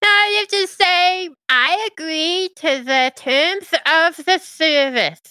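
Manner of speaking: brisk little servant imp. Acted. GM voices imp.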